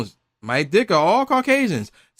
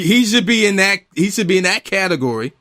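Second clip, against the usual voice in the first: ordinary talk, nothing marked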